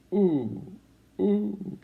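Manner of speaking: high hooting train whistle. Acted. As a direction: hoot like a lonely toy train. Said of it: "going chuck, chuck, and blowing for crossings".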